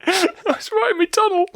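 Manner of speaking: squeaky high giggling line; laughing. Real, not acted. Innuendo joke amid laughter.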